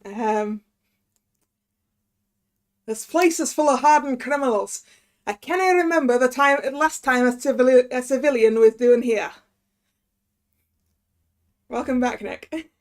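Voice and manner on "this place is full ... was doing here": gruff-talking Scottish prison governor, louder; Scottish accent. Acted. Governor grumbles about hardened criminals and civilians, Scottish accent. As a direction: put on a loud Scottish accent. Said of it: "okay, we gotta do Scottish".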